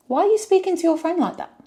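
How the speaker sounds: high, fast, outraged question; indignant challenge. Retold or imitated. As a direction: fire it at him like a scolding. Confronting Shaq's treatment of friend.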